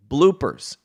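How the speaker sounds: ordinary delivery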